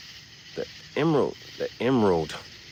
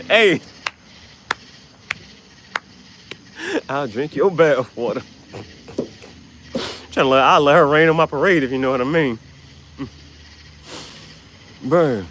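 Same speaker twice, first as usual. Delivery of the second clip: excited shout; 6 semitones higher, 6 dB louder than usual. Real, not acted. Hyped reaction to photo.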